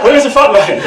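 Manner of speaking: high, loud Surrey enquiry; best Surrey accent. Retold or imitated. Teenager asking for the front line, Surrey accent. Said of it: "in my best Surrey accent".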